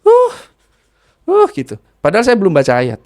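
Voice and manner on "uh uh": sharp high mock groans of pain; imitating a patient's strained cry. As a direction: yelp out a pained uh, uh. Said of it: "Langsung gini".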